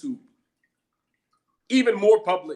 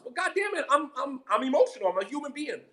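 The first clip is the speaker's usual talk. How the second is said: much higher, exasperated; exasperated outburst; 6 semitones higher, faster than usual. Real, not acted. To those calling him emotional, an exasperated outburst. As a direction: burst out in frustration.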